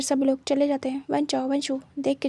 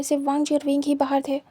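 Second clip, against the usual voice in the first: usual speaking voice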